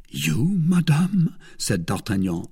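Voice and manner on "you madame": feigned surprise, leaping up on the last word; affected surprise. A narrator's reading. Suitor hearing the lady has an enemy, feigning surprise. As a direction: pretend to be taken aback. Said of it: "affecting surprise".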